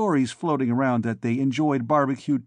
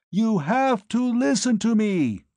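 much higher, slow roar over the noise; 10 semitones higher, 3 dB louder than usual. A narrator's reading. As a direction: roar it into her ear. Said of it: "I roared in her ear".

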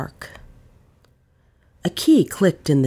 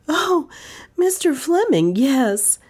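startled; 11 semitones higher, slower than usual. Acted. Startled young woman answering.

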